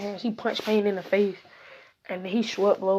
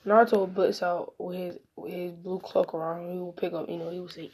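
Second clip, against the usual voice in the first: plain reading voice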